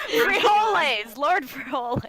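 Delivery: loud laughing yell; laughing shout. Real, not acted. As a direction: blurt it out laughing. Laughing exclamation of joke name.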